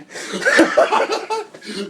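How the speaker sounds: loud high cackle of triumph; prank reveal laughing. Real, not acted. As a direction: burst into a loud gleeful laugh.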